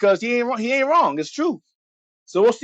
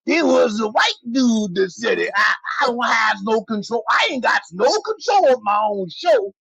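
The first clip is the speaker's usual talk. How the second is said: minstrel act; 3 semitones higher than usual. Acted. Playing a subservient minstrel figure.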